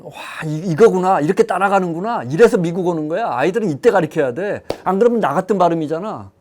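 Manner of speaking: excited realization. Acted. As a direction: gush as if a great truth just dawned on you. Acting out parents' excited realization. (깨달은 듯 들뜬 부모 흉내)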